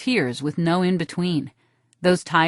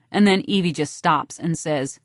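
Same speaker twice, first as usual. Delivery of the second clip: ordinary delivery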